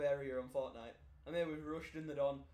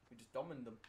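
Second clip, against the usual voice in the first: normal talking voice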